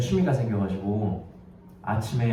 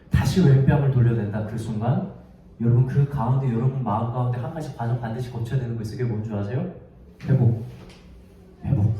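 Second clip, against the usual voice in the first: ordinary talk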